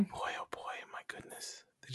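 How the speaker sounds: whispered dismay; breathy whisper under the breath. Real, not acted. Dismayed aside about bad effect.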